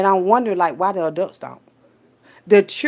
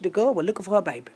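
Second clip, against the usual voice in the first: softer, quicker reported line; quiet retelling; 8 dB quieter, faster than usual. Retold or imitated. First take of retold line.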